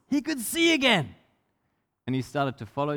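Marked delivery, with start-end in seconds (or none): 0.0-1.1 gasping, then high-pitched wonder; amazed gasp. Acted. Storyteller as blind man's sight returns, gasping in amazement. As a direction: gasp and marvel at it.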